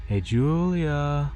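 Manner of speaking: drawn-out, high sing-song call; sing-songy call. A narrator's reading. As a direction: sing her name out slowly. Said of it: "in a sing-songy tone".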